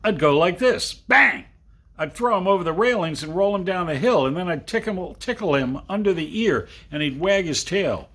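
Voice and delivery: put-on high, loud, fast kid's boast; excited boast. Boy tells his friend about the hippo, excitedly. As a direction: brag it out like an excited child.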